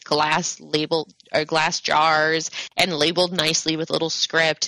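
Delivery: usual speaking voice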